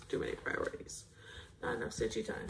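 breathy whisper, half to herself; whispered aside. Real, not acted. Stitcher muttering to viewers about her backlog, whispered. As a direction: whisper it under your breath like a rueful confession. Repeats worry about priorities.

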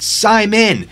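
high, loud call of a name; yelling out. A narrator's reading. Frightened hiker tempted to call his friend, yelling out. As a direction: raise the voice and call into the distance. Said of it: "tempted to yell out".

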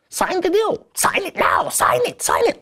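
very high-pitched, distorted demon imitation. Old manager possessed on loudspeaker, high demon voice. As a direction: imitate a demon demanding a signature in a piercingly high voice. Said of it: "his voice changed in, like, a, like, a demon's voice".

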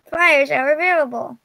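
ordinary delivery, nothing marked

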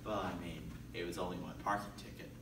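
normal talking voice

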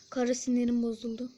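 ordinary talk, nothing marked

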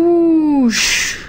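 high whooshing gust; gust of wind. A narrator's reading. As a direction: blow it in like wind. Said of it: "Flinke spetters waaien".

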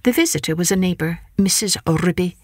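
ordinary delivery, nothing marked

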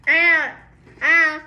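normal talking voice

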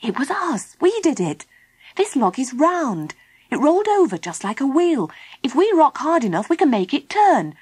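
high, eager voice with bouncy emphasis; excited explaining. Acted. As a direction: explain a brilliant plan with growing enthusiasm. Philip excitedly explains his escape idea.

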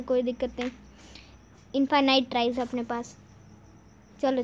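ordinary talk

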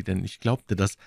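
ordinary delivery, nothing marked

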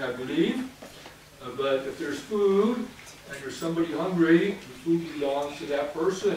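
ordinary talk

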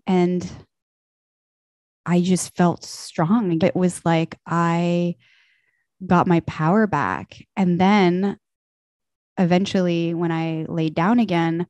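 ordinary talk

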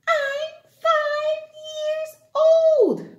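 put-on high, slow singsong child. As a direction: sing it like a little kid.

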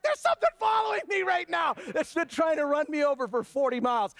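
high, shouted panic of a horse being chased; mock panicked horse. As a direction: yell it like a terrified horse fleeing the buggy. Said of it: "He's like".

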